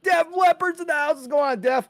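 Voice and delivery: high, loud announcing call; hyped shout-out. Real, not acted. Host welcoming a viewer to the house, hyped. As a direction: announce a guest's arrival at high pitch.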